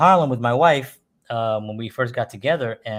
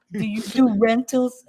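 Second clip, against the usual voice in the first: much higher, slow innocent ask; naive squeaky voice; 12 semitones higher, slower than usual. Retold or imitated. Asking the mob restaurant for a birthday party, high naive squeak. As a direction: play the clueless innocent, pitched way up.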